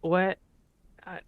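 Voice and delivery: ordinary talk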